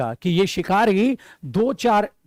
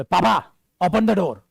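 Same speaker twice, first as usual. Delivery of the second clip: rude command; slower than usual. Shown as a demonstration. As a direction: demand it rudely. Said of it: "पापा दिमाग खोल देंगे डंडे से तुम्हारा". (पापा को हुक्म देते हुए, बदतमीज़ी से)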